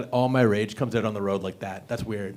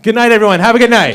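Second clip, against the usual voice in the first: loud sign-off; 8 semitones higher, 9 dB louder, faster than usual. Real, not acted. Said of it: "signing off".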